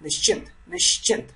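"nischint" run as one word, high and breathy; said together. Shown as a demonstration. Tutor showing learners how to join "nischint" together. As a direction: blend nish and chint into one smooth "nischint". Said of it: "Together you need to make like nischint".

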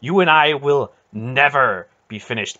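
loud, mounting impassioned rebuke; intense. A narrator's reading. Aunt telling Garion all she has endured, intense. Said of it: "the intensity of Aunt Paul's words".

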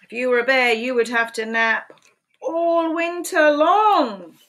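lively picture-book read-aloud, questions pitched high; animated storytime voice with playful questions; reading to children. Acted. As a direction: read it like storytime for little ones.

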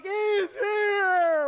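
"He's here!" shouted high and loud; excited shout. Acted. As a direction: burst out with the news. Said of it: "Philip is like".

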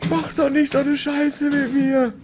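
high shaken protest; real panic, voice still high; panicked. Real, not acted. After jump scare.

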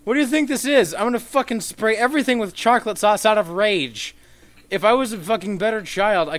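high, loud furious rant; frustrated gamer yelling at lag; angry rant. Real, not acted. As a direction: rage at the game out loud. Raging at laggy game.

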